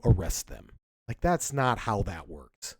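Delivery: ordinary talk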